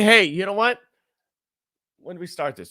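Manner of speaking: normal talking voice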